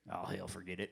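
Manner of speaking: low, soft mock grumble; Big Al giving up. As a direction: toss it off like a lazy big guy. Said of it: "He goes".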